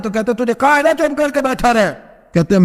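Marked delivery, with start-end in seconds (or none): 0.4-1.9 sharp, fast, higher rebuke; stern rebuke. Acted. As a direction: snap it out as a stern elder. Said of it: "حضرت عمر نے جلال سے دیکھا". (سخت جلال میں تیز ڈانٹ)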